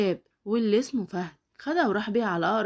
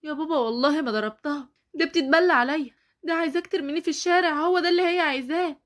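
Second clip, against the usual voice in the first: higher, fast, pleading protest; desperate pleading; 6 semitones higher, 4 dB louder than usual. Acted. Daughter pleading with her father, higher and urgent. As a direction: plead your innocence in a rush.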